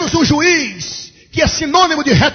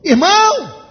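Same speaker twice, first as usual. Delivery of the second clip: louder and higher for a bad phone line; a pastor repeats his greeting to a caller who didn't hear, louder; repeated louder; 6 semitones higher, slower than usual. Real, not acted. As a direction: say it again so he hears.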